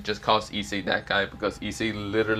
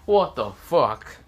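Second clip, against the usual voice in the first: stunned; 5 dB louder, much slower than usual. Acted. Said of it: "so many people are, like, saying".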